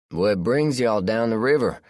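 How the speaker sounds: relaxed country man, unbothered; Josh, southern man. A narrator's reading. Josh voiced by narrator.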